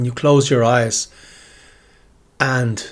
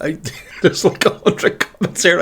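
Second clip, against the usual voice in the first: laughing; 6 semitones higher than usual. Real, not acted. Overwhelmed by fan comments.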